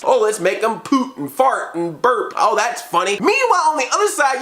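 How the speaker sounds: ordinary talk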